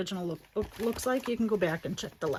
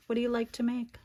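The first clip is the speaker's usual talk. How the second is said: ordinary talk, nothing marked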